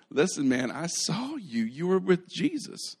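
high-pitched mock accusation; lightly voiced insistent girl. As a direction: play a schoolgirl who won't let it go.

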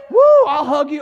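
hug-everyone exuberance, played up; mock giddy covid survivor; carefree whoop. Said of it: "they walk around like".

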